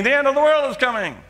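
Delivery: mock doomsday scream, high-pitched; retold scream. Street prophet warning Manhattan of the end, retold scream. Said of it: "and start screaming".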